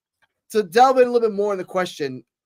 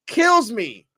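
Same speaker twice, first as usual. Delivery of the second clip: normal talking voice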